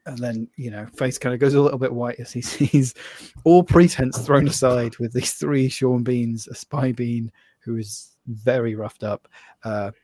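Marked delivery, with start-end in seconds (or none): none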